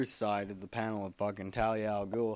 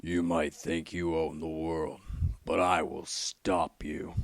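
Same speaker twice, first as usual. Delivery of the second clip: Batman voice; 6 semitones lower than usual. Retold or imitated. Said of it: "as Batman might say".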